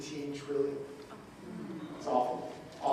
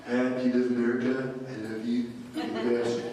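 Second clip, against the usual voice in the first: Trump impression; 7 semitones lower than usual. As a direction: do your best Trump. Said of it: "I can do a somewhat decent Trump impression".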